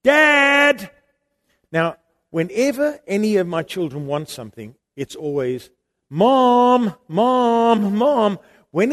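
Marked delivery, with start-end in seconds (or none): 0.0-0.9 put-on higher, loud call of a frightened boy; child's shout. Son calling louder in storm. 6.1-8.4 put-on higher, loud nagging child calling Mom; child whining.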